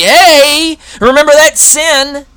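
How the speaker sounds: high, loud jeering call; taunting accuser. Acted. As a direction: poke at the old sin. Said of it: "And not only".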